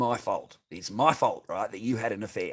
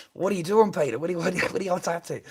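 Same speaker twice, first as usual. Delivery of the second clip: put-on drunk mate; 8 semitones higher, 5 dB louder, faster than usual. Drunk mate calling late at night.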